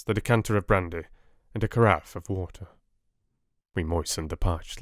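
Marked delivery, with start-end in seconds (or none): none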